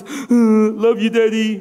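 lightly voiced little boy making up with Dad; hesitant grunt, then a child's declaration of love; imitated scolded child. Scolded son tells his father he loves him, imitated child voice.

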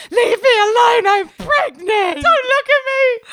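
high, loud mock-hysterical protest; upset, flustered outburst. Pregnant woman caught out by her brother, upset and flustered. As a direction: wail it loudly, an overwrought re-enactment. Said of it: "so upset and flustered and emotional".

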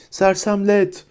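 high cry without extra volume; shouted spell. A narrator's reading. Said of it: "yeniden nişan alıp bağırırken".

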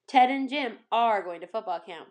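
ordinary delivery, nothing marked

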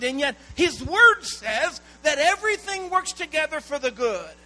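loud, driving sermon delivery, hammering each line home; preaching at full force. Acted. Pastor urging the congregation to hear this, in a loud preaching voice. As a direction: preach it out to the whole room.

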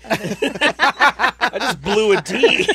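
high, loud, breaking into laughter; laughing hard. Real, not acted. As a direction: laugh through the words. Blowing nose, laughing.

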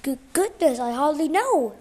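loud, stammering fluster. A narrator's reading. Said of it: "Miss Hardy stammered".

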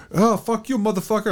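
high, mocking squeal of a curse; high, teasing coworker voice; mock coworker insult. Host imitating coworker's joking curse, high.

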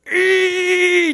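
loud, high, held "ee"; imitated little boy's squeal of excitement; excited toddler squeal. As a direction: squeal like an over-excited three-year-old. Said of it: "just like that".

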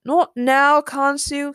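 usual speaking voice

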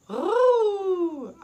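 high delighted squeal; excited ooh. Real, not acted. Excited about toy.

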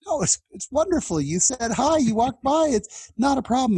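put-on high, eager boyish reassurance; flustered boys. Two starstruck teens reassure Elder Monson in high flustered voices. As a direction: rush to reassure him in a high voice.

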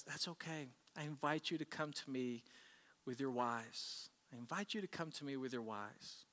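soft, unhurried invitation; quietly reassure, as God welcoming the troubled; gentle reassurance. Acted. Voicing God's gentle invitation to listeners.

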